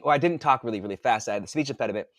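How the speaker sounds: normal talking voice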